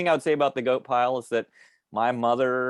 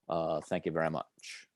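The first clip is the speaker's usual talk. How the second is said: [name] sign-off; 4 semitones lower, 7 dB quieter than usual. Acted. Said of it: "perfect, um, [name] impersonation".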